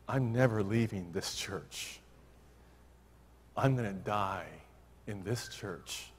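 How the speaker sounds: a quiet tearful promise, slowing down; dying woman to the pastor, tearful and soft; tearful vow. Retold or imitated. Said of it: "with tears streaming down her face".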